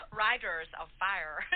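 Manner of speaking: New Zealand accent. Shown as a demonstration. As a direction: spell out "Riders of Fire" slowly in a New Zealand accent. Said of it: "my New Zealand accent".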